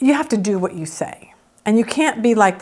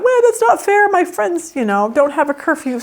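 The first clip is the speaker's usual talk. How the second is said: put-on whiny teen; 7 semitones higher than usual. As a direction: whine like a sulky teenager. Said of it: "let's add the wow into that".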